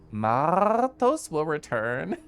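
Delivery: Martos will return slow with trilled R's and a laugh; slow trilled R's through a chuckle; rolled R's, laughing. Shown as a demonstration. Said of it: "I love rolling my R's".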